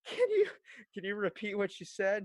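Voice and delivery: high, halting, holding back mock giggles; imitated suppressed laughter. Asking injured coworker to repeat it, mock stifled laughter. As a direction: act out struggling to stay serious. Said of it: "talk serious, but I'm also laughing so hard".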